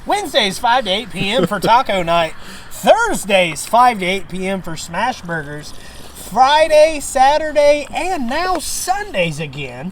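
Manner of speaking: high, loud ad announcer; radio ad read. Pretend. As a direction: sell it like a local radio spot. Mock radio ad for the bar.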